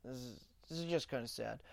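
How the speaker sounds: low, soft, quick mumble under the breath; mumbled movie quote. Retold or imitated. Quoting Seth Rogen's closet scene, low soft mumble. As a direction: drop it low and quiet, rattling it off. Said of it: "he's like".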